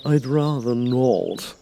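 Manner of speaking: slow, otherworldly cat voice; a lazy, sly refusal; Cheshire Cat. Acted. The Cat refuses the King, slow and sly. Cat's voice.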